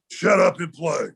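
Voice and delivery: imitated gruff, curt command; mock grouchy put-on voice; grouchy old player. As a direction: act the grumpy veteran dismissing a rookie. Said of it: "just a grouchy old dude".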